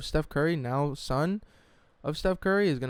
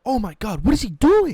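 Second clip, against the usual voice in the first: high, loud disbelieving cry; loud stunned outburst at the fight; shocked exclaim; 9 semitones higher, 9 dB louder than usual. Retold or imitated. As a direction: gasp it out like a stunned fan. Crowd freaking out at brawl.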